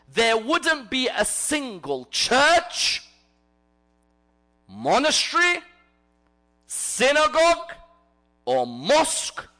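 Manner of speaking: slow, loud list with heavy pauses; emphatic preaching. Real, not acted. Preacher lists places of worship for the congregation, emphatic preaching. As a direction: drive home each place of worship, stretched and separate.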